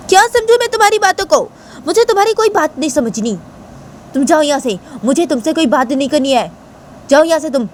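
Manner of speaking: high, forceful acted retort; angry outburst. A narrator's reading. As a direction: snap back and order them out. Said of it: "क्या इतना गुस्सा कर रही हो".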